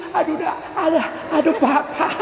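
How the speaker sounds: high, laughing mock cry of pain; mock pained mother. Preacher acting a nursing mother calling her husband, high and laughing. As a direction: squeal it like a bitten mother.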